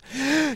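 high sudden gasp; mock gasp; imitated gasp. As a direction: gasp at the idea.

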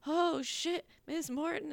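high startled gasp of recognition; surprised. Retold or imitated. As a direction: caught off guard, voice jumping up. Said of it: "and he was like".

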